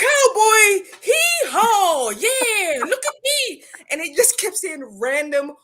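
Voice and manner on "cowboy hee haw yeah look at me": high, loud hammed-up cowboy yelling; fake-English gibberish for laughs; mock American English. Chinese video guy mocking Americans, high loud cowboy hollering. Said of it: "Pretend to talk English".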